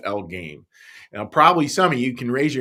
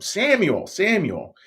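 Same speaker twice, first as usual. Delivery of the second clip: lightly called name, pitched up; a higher calling-out; 8 semitones higher, slower than usual. Acted. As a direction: call out to a sleeper, voice lifted. Voice calling the sleeping boy's name.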